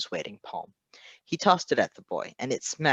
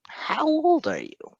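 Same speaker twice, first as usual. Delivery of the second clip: slow, much higher, intense disbelief; stunned question; 5 dB louder, slower than usual. Acted. Patton realizing the boy is a child, stunned. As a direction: drag the question out in shock.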